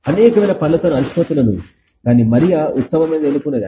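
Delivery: ordinary talk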